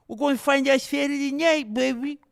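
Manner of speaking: high, loud hype impression; Max Holiday. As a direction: belt it out like a fighter's sound drop. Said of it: "did you actually think that was Max Holiday".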